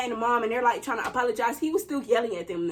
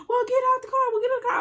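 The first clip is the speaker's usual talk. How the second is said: high mocking yell; mock her snapping back, pitched up; imitating mom's high snap; mock shrill mom; 10 semitones higher, much faster than usual. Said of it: "She's talking about".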